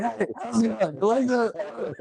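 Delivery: mock him while kicking; mock taunting kicker. Retelling Tarantino's kicking taunt, high hammed-up voice. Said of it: "It's like".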